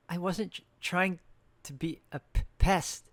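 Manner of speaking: halting, choked with tears, stumbling on words; a novice near tears pleads with stern Eustace, sniffling; tearful stammer. A narrator's reading. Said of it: "I started sniffling".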